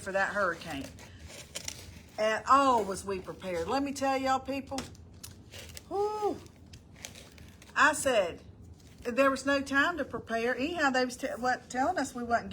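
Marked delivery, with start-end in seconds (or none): 5.9-6.4 a sharp high exhale, Whew. Real, not acted. Remembering the hurricane to viewers with a high whew. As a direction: blow it out high like relief.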